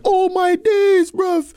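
very high, loud, drawn-out mock astonishment; imitated street boys. Somali boys teasing their friend, high, loud and incredulous. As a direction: hoot it like a scandalised teenage boy. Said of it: "their friends was like".

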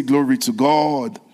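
slow, loud declaration; rousing slow praise line; call and response. Shown as a demonstration. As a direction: lead the church to repeat it. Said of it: "Somebody say".

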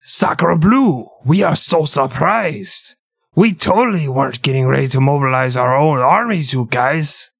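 mock French accent. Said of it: "which allowed France to say".

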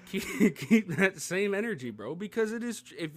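half-laughing emphatic repeat. Real, not acted. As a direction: repeat the line through real laughter. Laughs while repeating his point.

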